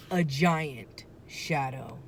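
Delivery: ordinary delivery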